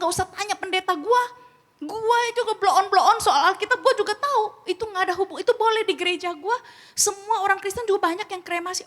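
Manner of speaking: high, loud indignant comeback; heated retort. Retold or imitated. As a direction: fire back defensively at the questioner. My own retort at the funeral home.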